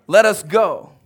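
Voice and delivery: loud, firm command. Acted. Jesus commanding the disciples to cross, loud. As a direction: order it, no question. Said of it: "it was a command".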